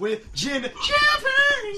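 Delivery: high, slow announcer shout. Acted. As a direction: call it out like a host. Show intro shout.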